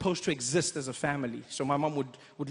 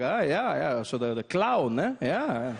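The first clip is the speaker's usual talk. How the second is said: put-on German accent; slower than usual. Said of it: "He's like".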